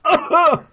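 sharp high cry of pain; pained yelp. Retold or imitated. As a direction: yelp as the old wound twinges. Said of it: "and then it goes".

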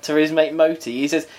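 normal talking voice

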